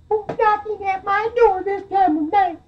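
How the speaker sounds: very high falsetto piglet; scared little piggy. Acted. Scared piggy woken by banging at his door, high falsetto. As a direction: squeal it as a tiny startled pig. Startled piggy calls to the knocker.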